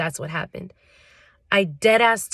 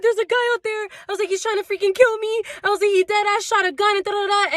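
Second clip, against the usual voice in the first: frantic; 11 semitones higher, faster than usual. Retold or imitated. Panicked retelling to roommate.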